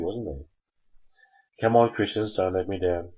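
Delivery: usual speaking voice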